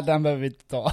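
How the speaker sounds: ordinary talk, nothing marked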